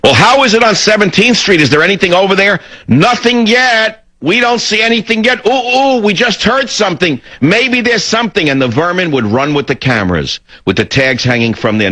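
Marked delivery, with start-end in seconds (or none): 0.0-8.5 much higher, mock-hysterical female TV reporter; hysterical women reporters. Radio host mimicking TV reporters hunting for violence, mock-hysterical. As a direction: put on a high, over-excited reporter voice, mocking them. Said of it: "one of the hysterical women would say".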